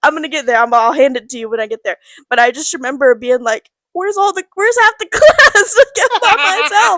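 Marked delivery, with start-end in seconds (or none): 3.9-7.0 put-on high, louder, slowed, alarmed kid voice; like a third grader suddenly left alone; panicked disbelief. Kid alone, class sent away.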